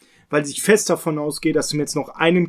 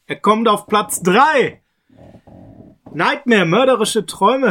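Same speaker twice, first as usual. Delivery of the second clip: higher, slow, drawn-out announcement; emphatic show-host title call; dramatic countdown announcer; 4 semitones higher, 4 dB louder, slower than usual. Pretend. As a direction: proclaim the ranking like a big reveal. Announces his ranked film title.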